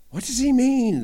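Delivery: high, loud, baffled question; puzzled disciples. Acted. The disciples ask each other about Jesus, high and baffled. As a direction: blurt it out, completely lost.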